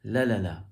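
"La la la" with the first la stressed; "La la la", strong start, trailing off; stress on first syllable. Shown as a demonstration. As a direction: punch the opening la of "La la la". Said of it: "That's emphasizing the first one".

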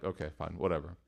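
soft, low, giving in; a sigh of okay fine whatever; resigned mutter. Retold or imitated. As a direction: shrug it off quietly, beaten. Resigned reply to wife.

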